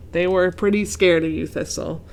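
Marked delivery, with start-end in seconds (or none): none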